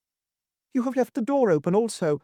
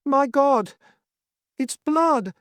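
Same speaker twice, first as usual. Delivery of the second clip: high, louder horrified cry; 3 dB louder, much slower than usual. Acted. Old lord crying out as he finds blood, high and horrified. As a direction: gasp it out as you see the blood. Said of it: "I heard him cry out".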